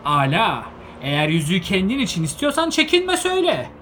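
loud high shout. A narrator's reading. Bilbo shouting at Gandalf to just admit it. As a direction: lash out at the wizard. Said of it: "diye bağırdı".

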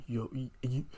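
ordinary delivery

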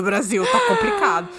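high exaggerated "Tá complicado"; mock despair. Real, not acted. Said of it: "exaggerated repeat".

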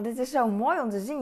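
usual speaking voice

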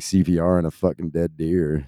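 ordinary delivery

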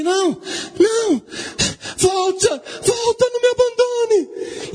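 high, wailing acted plea; anguished call to come back; desperate begging. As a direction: cry out as if being abandoned. Abandoned king begs wife to return.

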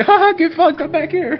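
high, taunting jeer from the back; mocking sister. Sister jeers at the fighter from behind, high and mocking. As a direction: crow it at him from safety. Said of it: "she's like".

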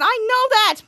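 high, loud comeback; acted burst of irritation; irritated retort. Hero arguing with his sidekick, irritated.

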